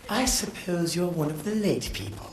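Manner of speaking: lightly voiced snooty official; a hint of a sneering official; snooty remark. Official sneering at the late traveller.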